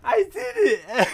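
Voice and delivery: high, slow, laughing shout; laughing triumphant scream. Real, not acted. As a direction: cry it out in joyful triumph. Triumph right after success.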